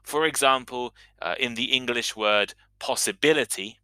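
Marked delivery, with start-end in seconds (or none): none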